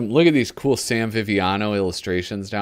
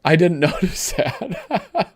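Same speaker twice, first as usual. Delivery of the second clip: laughing; 8 semitones higher, slower than usual. Real, not acted. Reacting to funny comic panel.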